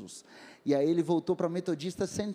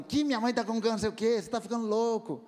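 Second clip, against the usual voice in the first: raised-pitch imitation of an indignant reaction; mock scolding; 4 semitones higher than usual. Imagining the woman scolding him about her mother, mock indignant. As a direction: act out a startled telling-off, no real shouting. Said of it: "ela não brigou comigo, falando".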